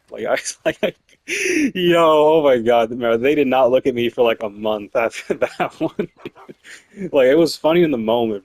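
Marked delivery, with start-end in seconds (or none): none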